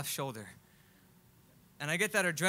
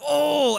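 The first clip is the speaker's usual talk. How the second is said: winded oh; 8 semitones higher, 10 dB louder than usual. Retold or imitated. Tyler punched in the stomach.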